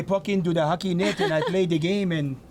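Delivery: hammed-up French Canadian hockey voice; French Canadian accent. Commentator offers French Canadian, hams it up laughing. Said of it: "I can do French Canadian".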